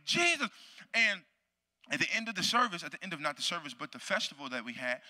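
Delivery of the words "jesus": high, sudden preacher's cry; re-enacted frenzied preaching. Preacher showing how crazy he got, frenzied preaching. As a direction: cry the name out like a wild preacher. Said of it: "I'm going crazy".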